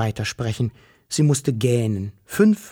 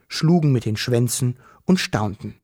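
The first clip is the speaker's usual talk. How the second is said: usual speaking voice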